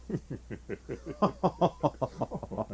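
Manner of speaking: low, soft scornful chuckle; mocking laugh. Doctor and preacher scoff at the king, low laugh. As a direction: laugh off the king's speech quietly. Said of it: "and then started to laugh".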